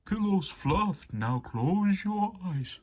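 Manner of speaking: porter's voice. A narrator's reading. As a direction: sing it out like a kindly porter. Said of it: "the porter cries".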